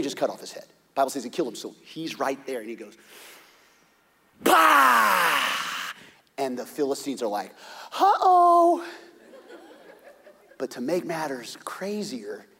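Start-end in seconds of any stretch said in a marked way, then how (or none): 4.4-5.4 loud high bah shout; battle yell. Acted. David striking the giant. 8.0-8.8 very high, loud mock alarm; comic uh-oh. Philistines seeing disaster.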